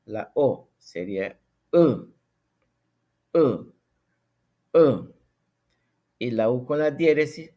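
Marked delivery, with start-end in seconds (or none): 0.8-5.1 'U' voiced with the mouth set for E, sounding O with diaeresis; Ngäbere vowel demo. Shown as a demonstration. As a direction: repeat 'U' clearly, a bit louder each time. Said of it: "se pone la boca para pronunciar".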